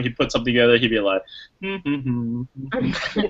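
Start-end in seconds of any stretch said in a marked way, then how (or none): 1.6-3.3 soft, nonchalant little hum; imitated absent-minded humming. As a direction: hum idly as if tinkering with something simple. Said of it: "so ho-hum about technology".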